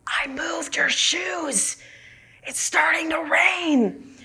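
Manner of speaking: slow, high, unvoiced whisper. Meditator telling a friend about the shoes, slow whisper. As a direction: whisper slowly so no one else hears. Breaking silence to tell friend.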